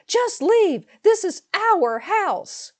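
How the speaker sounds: shrill high scream, stretched slowly. Retold or imitated. Sister-in-law points at the door and screams at the narrator. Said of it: "scream at this point".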